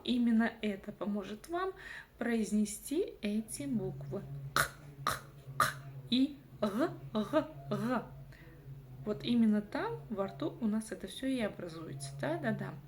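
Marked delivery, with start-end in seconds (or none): 4.5-5.2 «К, к» отрывисто из горла, без голоса; произнеси «К, к», будто отхаркиваешься; отхаркивающее «К». Shown as a demonstration. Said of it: "нужно отхаркнуться через эти буквы". 6.6-8.0 «г» гортанно, с хрипом; произнеси «г, г, г», будто отхаркиваешься; отхаркивающее «г». Shown as a demonstration. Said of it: "нужно отхаркнуться через эти буквы".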